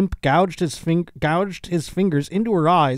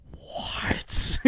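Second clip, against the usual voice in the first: breathed, drawn-out whisper of disbelief; mock stunned whisper; 8 dB quieter than usual. Man told his cheese is cauliflower, imitated stunned whisper. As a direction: whisper it slowly, as if fury is building. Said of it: "he goes ... He just gets so pissed".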